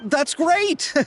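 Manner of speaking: relieved cheer; pleased. Acted. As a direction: glad the gift is a hit. Pleased the gift landed.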